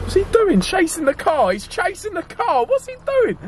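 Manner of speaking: high, incredulous yelps; can't believe he's chasing the car; shocked laughing disbelief; alarmed disbelief. Real, not acted.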